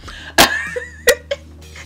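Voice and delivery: real burst of high-pitched laughter. Real, not acted. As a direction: break into a high laugh despite yourself. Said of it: "This is not funny".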